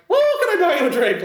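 very high, cartoonish mock-Irish voice; high-pitched Irish accent. Imitating the Irishman offering to buy a drink, high-pitched Irish accent. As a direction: squeal it like a cartoon Irish leprechaun. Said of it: "it literally sounded that high-pitched".

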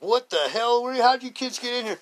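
high, loud startled bartender; high loud shocked bartender; angry bartender. Retold or imitated. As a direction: yell at the kids who popped out of the floor. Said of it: "The bartender was like".

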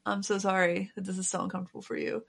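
ordinary delivery

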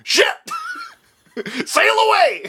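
high panicked yell with a laugh; laughing mock panic cry; panicked Washington. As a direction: shriek it like a fleeing general. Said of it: "He just turns around and be like".

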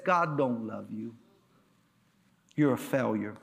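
low, soft put-down as Satan. Acted. Satan discouraging a believer, low and soft. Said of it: "and Satan says".

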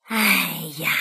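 low, drawn-out resigned sigh. A narrator's reading. Fox gives in to the hungry duckling, sighing low. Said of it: "狐狸叹着气".

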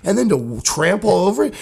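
retold furious tirade at the seat cover culprit; angry rant. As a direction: unload on the guy like you're back in that bathroom. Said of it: "But I wanted to hear, like".

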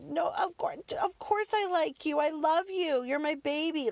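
high, gushing motherly comfort; anxious reassurance. Retold or imitated. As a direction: rush to soothe an upset child. Frantically reassuring upset son.

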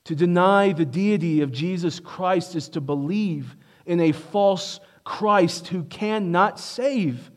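usual speaking voice

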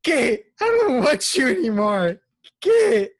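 high, loud, slow wailing plea to a dog; mock sobbing owner. Friend acting out Martin crying at Air Bud, high loud mock wail. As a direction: wail it like a heartbroken kid sending his dog away. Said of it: "he's like".

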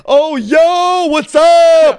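mock the hosts' over-excited hello; hyped greeting. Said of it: "they try to act more exciting".

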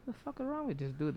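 hushed, quick aside to himself; inward muttered thought. Retold or imitated. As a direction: say it under your breath, quickly. Thinking to himself during the story.

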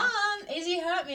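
high, loud mock whine to Mum; tattling child. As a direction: wail it like a little telltale.

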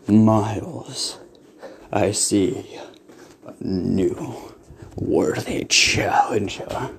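slow, low whispered villain; whispery Lich voice. Acted. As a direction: breathe it out like an undead king.

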